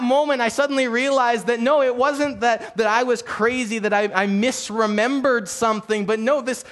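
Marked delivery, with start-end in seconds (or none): none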